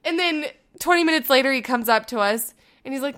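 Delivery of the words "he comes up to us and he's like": ordinary delivery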